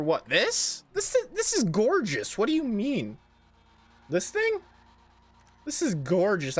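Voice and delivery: high, mock-indignant voice; mock offended. Mock defends ugly car.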